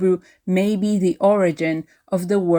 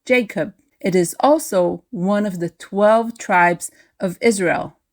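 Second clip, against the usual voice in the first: usual speaking voice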